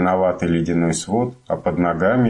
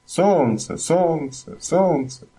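fading echo; 10 semitones higher, much slower than usual. A narrator's reading. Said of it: "вторило, удаляясь всё дальше".

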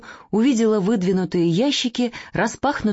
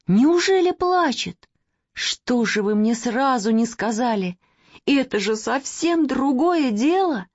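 excited; 6 semitones higher than usual. Acted. Kostya suddenly hopeful.

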